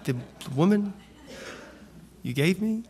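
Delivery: a caught man mumbling the excuse; sheepish mumble. Retold or imitated. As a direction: shrink away as you say it. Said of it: "maybe it was even that move".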